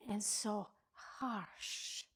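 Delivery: slow, whispery and pained; hushed hurt. Acted. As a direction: draw it out softly, almost whispered. Dwells on the hurtful word.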